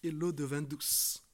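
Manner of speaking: ordinary talk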